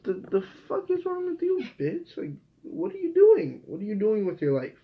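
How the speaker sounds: very high indignant squeal, dropping by the end; mock outraged rant. Vlogger mocking himself scolding Ruby, very high indignant squeal. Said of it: "I was like".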